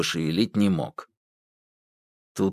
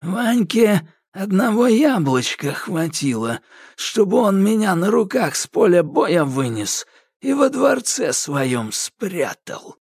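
evil smirk; 8 semitones higher than usual. A narrator's reading. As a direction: gloat with a wicked smile. Koshchei gloats to Yaga. (Кощей злорадно улыбается)

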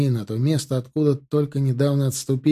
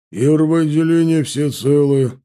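loud, raised, formal shout; calling back a report; 4 semitones higher, 7 dB louder than usual. A narrator's reading. Chanov answering for first section, loud call. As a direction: sing out the report to the commander. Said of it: "откликнулся Чанов".

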